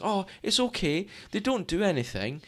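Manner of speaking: a high, airy brush-off; breezy dismissive owner. Retold or imitated. Dog walker brushing off the request, high and breezy. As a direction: wave away the concern lightly.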